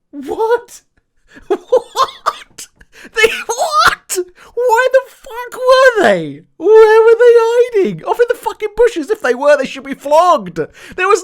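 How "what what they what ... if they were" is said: much higher and louder, laughing in disbelief; incredulous laughing shout. Real, not acted. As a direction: shriek with disbelief and laughter at the absurdity. Disbelief at the surviving army.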